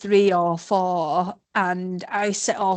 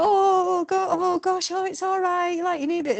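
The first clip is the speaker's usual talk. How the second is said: very high, fussing coo; gushing sympathy; 9 semitones higher than usual. Retold or imitated. Safety team member fussing over a runner, high coo. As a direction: fuss over her like a worried aunt. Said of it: "what I expected Ian ... to go".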